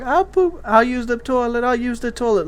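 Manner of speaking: imitating a desperate little boy; pleading little kid. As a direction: beg like a toddler giving in fast. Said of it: "then he's all like".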